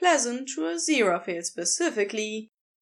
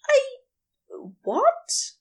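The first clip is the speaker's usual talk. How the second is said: much higher, stunned disbelief; startled squeak. Acted. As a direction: caught off guard, blurting. Aziraphale shocked by invitation.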